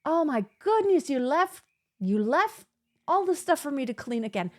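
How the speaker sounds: a hint of a fed-up mother scolding; exasperated mom. Mother scolding daughter over dishes left out, lightly exasperated. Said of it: "in a way it's like".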